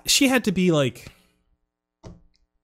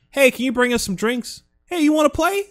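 carefree mom; 12 semitones higher, 4 dB louder than usual. Retold or imitated. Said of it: "The mom is like".

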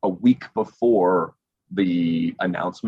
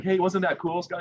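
cheery host voice; 10 semitones higher than usual. Retold or imitated. Hosts voiced, high and cheery.

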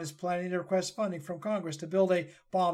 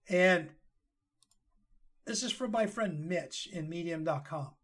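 ordinary delivery

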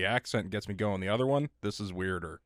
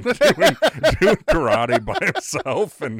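laughing; 8 semitones higher, 12 dB louder, slower than usual. Real, not acted. Laughing through drunk dad story.

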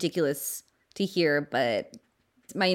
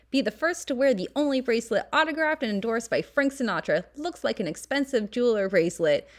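vintage ad read; 5 semitones higher than usual. Acted. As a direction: sell it like a 1940s announcer. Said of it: "And the flyer says".

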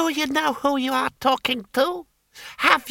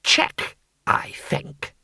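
breathy whisper of a threat; whispered taunt; much slower than usual. A narrator's reading. Lord Hong taunting Cohen over his hostage, a whisper. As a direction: whisper it, savouring the win.

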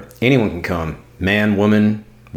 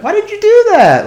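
way up high and loud, mock outrage; high squeaky demo; 8 dB louder than usual. Shown as a demonstration. To his cohost, showing how tone changes a text, shrill high mock question. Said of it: "you can see the inflection of my voice".

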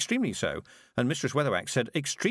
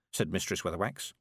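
plain reading voice

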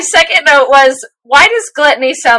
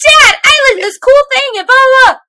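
much higher, whiny mock child; eager kid; 12 semitones higher than usual. Imitating the son telling his dad what he learned, high and whiny. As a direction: squeak it like a kid begging dad for praise. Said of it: "typical dad answer".

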